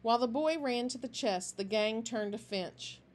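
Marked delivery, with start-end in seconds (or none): none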